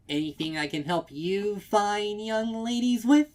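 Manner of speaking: high, loud and sing-song; bright eager helper; chirping mayor. A narrator's reading. Mayor greeting elderly customers with a chirp. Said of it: "she chirps".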